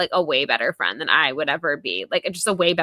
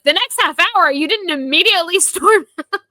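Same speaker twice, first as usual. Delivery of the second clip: high, loud, laughing disbelief; incredulous outburst; 11 semitones higher, 7 dB louder than usual. Acted. Host appalled Sydney didn't leave, incredulous outburst. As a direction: repeat it aghast, pitch shooting right up. Repeats line in outraged disbelief.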